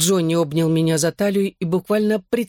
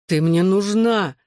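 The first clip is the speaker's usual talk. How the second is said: groaned; 3 semitones higher, slower than usual. A narrator's reading. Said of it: "застонал он".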